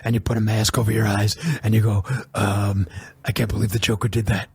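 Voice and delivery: low, put-on superhero voice; deep Batman voice. Shown as a demonstration. Showing co-host anybody can be Batman, deep voice. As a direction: drop down into a mock Batman voice. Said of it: "You just put on that deep voice".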